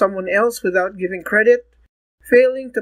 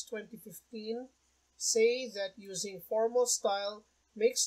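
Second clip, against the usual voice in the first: much softer reading voice; quiet; 15 dB quieter than usual. Real, not acted. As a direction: read it quietly, far from the mic. Same lesson, much quieter.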